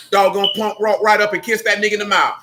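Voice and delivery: usual speaking voice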